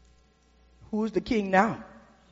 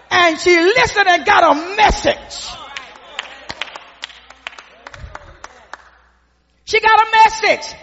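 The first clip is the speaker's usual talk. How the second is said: rising, louder preacher's cadence; preaching at full force; 7 semitones higher, 5 dB louder than usual. Acted. Preacher to the congregation, rising loud cadence. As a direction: build it up from the pulpit.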